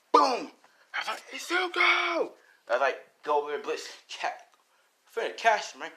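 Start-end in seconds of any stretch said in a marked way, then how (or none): 0.1-0.5 loud boom. Acted. Acting out an explosion noise. 1.3-2.3 high-pitched cry of a name; acted high yell; calling out. Calling out to fallen friend.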